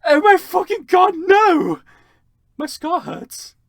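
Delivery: very high, loud anguished scream; very high, loud pained cry. Acted. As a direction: wail in pain. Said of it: "He started to scream".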